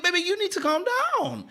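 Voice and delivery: hammed-up high-pitched telling-off; mock exasperation. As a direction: stretch it up high in playful disbelief. Acted exasperated reply to her.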